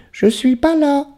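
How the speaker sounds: very high, small pretend voice; tiny little voice. Calling to happiness from inside, tiny high voice. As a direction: squeak it out in a little falsetto. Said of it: "d'une petite voix pour être sûr".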